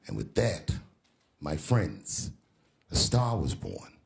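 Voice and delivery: low, spaced-out grand conclusion; mock-solemn storyteller. Comedian caps his debate story for the crowd, mock solemn. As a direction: close the tale gravely, pausing between phrases.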